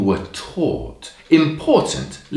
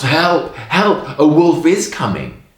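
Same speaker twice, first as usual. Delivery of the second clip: higher, louder cry for help; acted shout; 5 semitones higher, 6 dB louder than usual. A narrator's reading. Shepherd boy calling villagers as the wolf comes, shouting. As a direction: raise the alarm as in a storybook. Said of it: "And he shouts".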